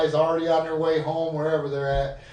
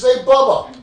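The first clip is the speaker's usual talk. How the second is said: laut und höher zurufen; calling out; 8 semitones higher, 10 dB louder than usual. Retold or imitated. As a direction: ruf deinem Kumpel quer durch die Bar zu. Calling a friend across the bar.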